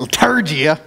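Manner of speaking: Liturgia in a light Oklahoma twang; a hint of Oklahoma in Liturgia; Oklahoma accent. Shown as a demonstration. Pastor jokingly says Liturgia with a slight Oklahoma accent. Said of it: "Gotta say it with a little Oklahoma accent".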